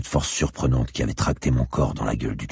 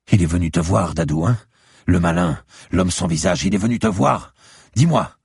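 louder, higher, urgent questioning; agitated old neighbour; 5 semitones higher, 5 dB louder than usual. Acted. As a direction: press for an answer, climbing higher at the end. Frightened old woman questions the hero. (vieille voisine agitée, question pressante qui monte)

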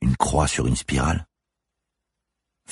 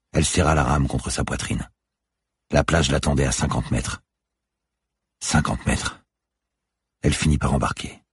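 ordinary delivery

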